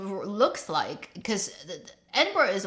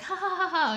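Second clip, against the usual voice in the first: high-pitched "ha ha ha" cackle; high mock giggling fit; imitated laugh; 10 semitones higher than usual. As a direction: act out bursting into uncontrollable laughter. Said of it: "I wanna laugh".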